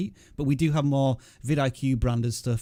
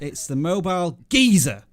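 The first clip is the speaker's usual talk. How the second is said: Cockney accent; 5 semitones higher, 6 dB louder than usual. Shown as a demonstration. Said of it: "in a Cockney accent".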